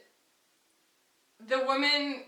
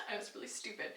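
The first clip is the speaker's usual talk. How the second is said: breathless, sheepish whisper; whispered aside; 11 dB quieter, faster than usual. Real, not acted. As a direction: admit it under your breath. Self-mocking aside.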